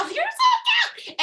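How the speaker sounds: gushing, laughing squeal, slowed down. Real, not acted. Host gushing over Nikki with a delighted laughing squeal.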